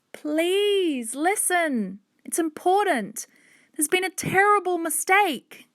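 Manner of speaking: high, urgent appeal; pleading child. A narrator's reading. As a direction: beg to be heard. Hannah pleads with furious man.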